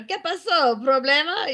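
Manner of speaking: high, innocent Spanish questions; playing the clueless foreigner; mock Spanish tourist. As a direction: feign confusion in Spanish. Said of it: "So I come up and I go".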